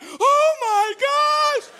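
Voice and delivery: high, loud panicked yell, acted out; freaked-out shout. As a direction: scream it like a horrified dad. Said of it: "we are just freaked out".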